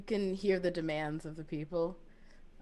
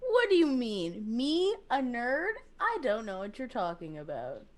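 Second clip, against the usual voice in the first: feigned innocence; 3 semitones higher than usual. Acted. Called a nerd, denying it with feigned innocence. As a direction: play innocent, exaggerating the questions.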